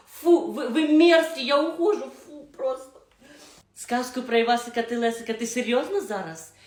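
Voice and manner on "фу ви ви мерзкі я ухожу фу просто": loud, high, repulsed; disgusted outburst. Acted. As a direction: storm out in disgust. Disgusted storming off.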